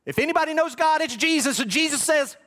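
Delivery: loud, high-pitched pulpit proclamation; impassioned preaching. Acted. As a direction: preach at full force, drawing out key words. Preaches at full force.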